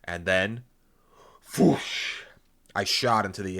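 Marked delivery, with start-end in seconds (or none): 1.6-2.2 loud, airy whoosh; imitated whoosh. A narrator's reading. Narrator launching into the air, loud breathy whoosh. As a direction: voice a sudden rush of air.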